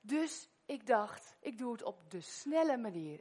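ordinary talk, nothing marked

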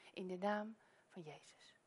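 whispered closing of a prayer; a hushed, whispered amen line. Acted. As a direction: breathe the last words of the prayer. End of prayer.

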